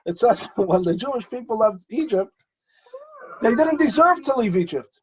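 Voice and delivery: laughing off tears, then voice breaking high; tearful retry. Real, not acted. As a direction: push through the emotion. Retakes line through tears.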